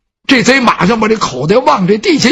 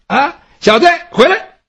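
calling out; 8 semitones higher than usual. Acted. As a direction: yell across the yard. Calling the thief back.

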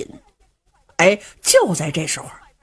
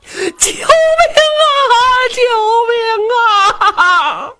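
wailing much higher shout, breaking; cry for help; 14 semitones higher, 3 dB louder, much slower than usual. Acted. Old father screaming for help outside, wailing. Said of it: "外边一阵大乱".

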